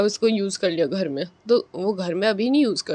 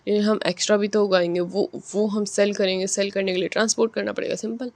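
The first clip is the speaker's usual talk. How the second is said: rushed talking; 4 dB quieter, faster than usual. Real, not acted. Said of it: "थोड़ी जल्दी जल्दी बोल रहा हूँ मैं".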